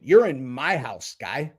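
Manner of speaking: ordinary delivery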